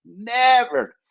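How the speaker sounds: "Never" shot up high and loud; emphatic never. Real, not acted. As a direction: slam the word "Never" alone. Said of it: "Japanese girls never say that shit".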